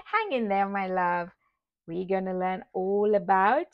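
ordinary talk

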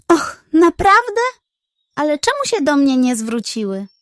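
high, loud thrilled gasp; delighted hen. Acted. Hen thrilled the squirrels want to hear her, high and loud.

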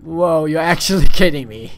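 loud, laughing surprise; delighted shout at the zombie cow; excited. Real, not acted.

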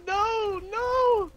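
high, anguished crying out; despairing no as the fight turns; panicked. Real, not acted. Losing the fight.

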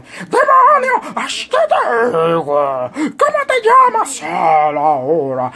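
high, loud preacher voice, slowing on the commands; mock preacher demanding the demon's name; anointed preacher. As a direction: rebuke the demon in full unction. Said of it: "esa es la unción".

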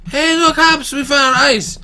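thrilled to show the dog; excited shout. Real, not acted. Gamer calling to his dog Hops, excited shout.

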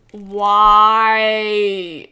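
mock-tragic wail; despairing why. Real, not acted. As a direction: mourn the lost work. Reveal glitched after hard work.

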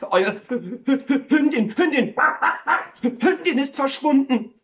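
loud, high, panicky stammer; agitated. Acted. Neighbour reports the missing dog, agitated and stammering. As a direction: get stuck on the words, then burst out loudly. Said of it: "sagte er aufgeregt".

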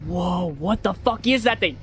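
ordinary talk, nothing marked

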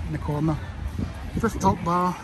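usual speaking voice

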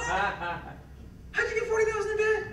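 high, slow outraged disbelief; stunned father. Retold or imitated. Father to daughter about her debt, high stunned disbelief.

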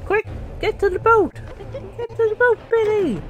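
high-pitched frantic order to escape; urgent calling out. Pretend. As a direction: hurry your friend to safety, hammed up. Urges Billy to flee.

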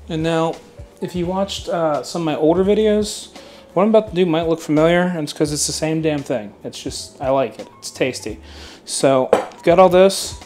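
ordinary talk

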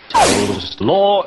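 pompous lawman. Acted. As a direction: boom it like a policeman. Policeman declares.

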